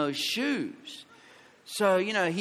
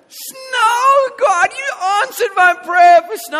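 much higher, loud crying shout of delight; overjoyed shout; 11 dB louder, slower than usual. Retold or imitated. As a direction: shout it out in joyful disbelief. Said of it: "I, I was like".

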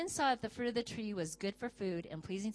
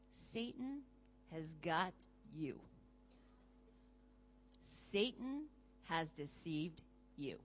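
slow, hushed and much softer, a dark warning; ominous warning; 6 semitones lower, 13 dB quieter, slower than usual. Acted. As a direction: lower and drop the voice, warning them of Satan. Preacher warns the congregation gravely.

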